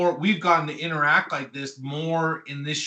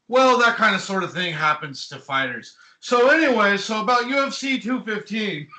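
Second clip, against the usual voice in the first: imitating Lad; 5 semitones higher than usual. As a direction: shrug it off and change the subject, half laughing. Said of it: "He goes".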